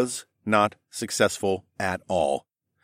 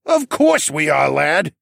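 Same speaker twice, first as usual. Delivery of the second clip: put-on loud, booming old koala; thundering; 6 semitones higher, 5 dB louder, slower than usual. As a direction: bellow it proudly. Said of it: "Tovish thundered".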